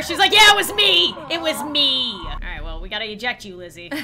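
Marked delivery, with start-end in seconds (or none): 0.3-2.1 loud, high start that drops away; brazen mock confession. Lizzie no longer hiding her crime, brazenly confessing. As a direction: blurt it out as an open admission. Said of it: "she's not hiding it anymore".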